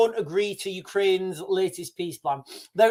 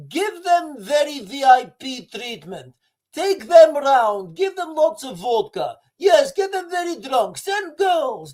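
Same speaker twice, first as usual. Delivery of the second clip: higher mock Russian accent, swaggering, with shouted bursts; mock Russian accent, a Kremlin boss plotting to trap guests; Vladimir Putin impression; 5 semitones higher than usual. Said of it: "this is so Vladimir Putin".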